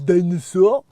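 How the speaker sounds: put-on loud French-accented gibberish; exaggerated French accent; mock French. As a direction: mimic a Frenchman with no English. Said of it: "couldn't freaking speak English".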